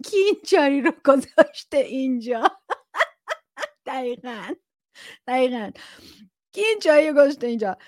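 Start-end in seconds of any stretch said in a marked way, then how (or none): 0.1-2.4 laughing through a put-on grumpy dad line; half-laughing, imitate a huffy father; mock annoyed dad. Quoting a listener's dad about the tea, laughing mock grumble. 6.5-7.8 higher, louder, very fast irritated father; rapid mock dad grumble. Host re-acts the father's tea complaint, fast mock irritation.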